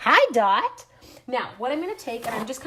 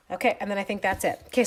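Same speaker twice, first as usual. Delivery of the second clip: usual speaking voice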